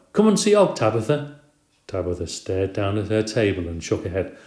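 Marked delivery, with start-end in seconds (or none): 0.1-1.4 calling out. A narrator's reading. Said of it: "Missus Brisbane called to the new girl".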